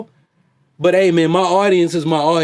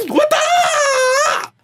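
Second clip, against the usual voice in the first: piercing high kiai shout, rising; very high martial-arts scream; Bruce Lee. Retold or imitated. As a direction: throw a kung fu battle cry. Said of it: "heard me do a Bruce Lee".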